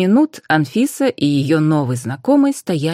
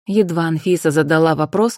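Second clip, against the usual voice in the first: usual speaking voice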